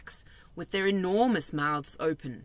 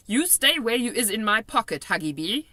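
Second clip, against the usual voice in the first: higher, louder friendly giant voice; kindly giant, raised voice; BFG giant voice; 6 semitones higher, 6 dB louder than usual. A narrator's reading. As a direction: play the big friendly giant, chatty and odd.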